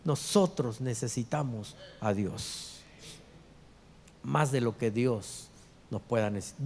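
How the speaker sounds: lightly voiced God, slow and low; low, unhurried pulpit rendering of God. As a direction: drop to a soft, slow, weighty correction. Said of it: "Dios dice".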